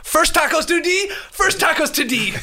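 high-pitched imitation of Jake's odd accent. Mocking Jake's odd accent when he names the first tacos. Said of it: "he goes, uh".

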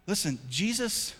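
usual speaking voice

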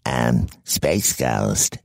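low, slow, deep hero voice; Space Ghost voice. Pretend. [name] answers the MC in a low, slow Space Ghost voice. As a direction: drop into a slow superhero boom. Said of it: "I can do Zorak".

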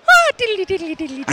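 high-pitched Irish diddly-dee; mock Irish. Co-host joining the Irish bit, mock Irish lilt.

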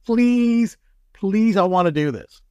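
retold begging to be let go; begging the boss. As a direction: beg like a kid asking permission. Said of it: "So I said to my boss".

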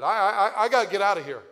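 loud, high, stammering panic; panicked stranger. Retold or imitated. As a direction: blurt it out in a frightened rush. Stranger panics, wants to leave.

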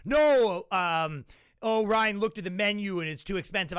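high, loud flustered no; mock awkward friend. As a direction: fumble the excuse. Friends declining on his behalf.

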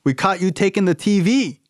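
interrupting dad. Retold or imitated. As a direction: butt in like an eager dad from the audience. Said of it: "stand up and say".

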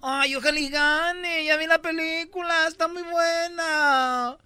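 high, slow snooty diva voice; drawn-out high-society lady impression; mock Laura Zapata. Mocking the actress on the interview.

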